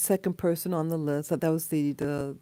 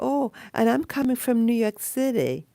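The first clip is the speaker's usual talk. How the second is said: slightly put-on odd voice, a bit higher; weird voice; 4 semitones higher, 4 dB louder than usual. Imitating a guy in a website video, weird voice. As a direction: lightly mimic a man's weird video voice, pitched up. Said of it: "This weird voice".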